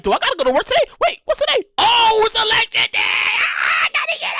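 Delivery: remembering election day in a high panic; rising panic. Retold or imitated. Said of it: "I'm like".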